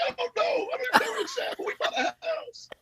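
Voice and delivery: very high squeal with laughter in it; squeaky put-on crying voice; mock tearful cry. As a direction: imitate blubbering, half laughing. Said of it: "And we're crying".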